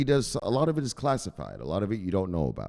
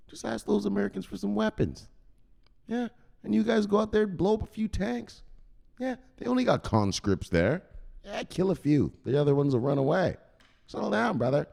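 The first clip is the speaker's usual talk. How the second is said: Robbie advising; 11 semitones higher than usual. Retold or imitated. Robbie voiced advising Zelensky.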